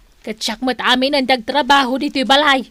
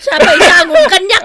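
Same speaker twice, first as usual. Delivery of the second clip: scolding shout; 6 semitones higher, 7 dB louder than usual. Acted. Wife scolds smoking husband.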